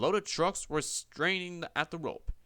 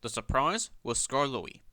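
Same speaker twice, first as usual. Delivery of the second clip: ordinary delivery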